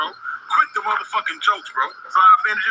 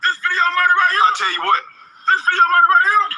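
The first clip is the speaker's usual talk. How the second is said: raised, angry threat; angry yelling; 7 semitones higher than usual. Real, not acted. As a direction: bark it at him furiously. Armed man threatening a heckler.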